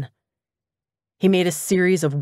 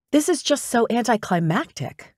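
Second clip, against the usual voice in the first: higher-pitched sulky whine; pouty complaint; 6 semitones higher than usual. A narrator's reading. Assistant sulks to her boss about paperwork, pouty. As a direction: protest with a pout in the voice. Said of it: "lower lip trying to jut out in a pout".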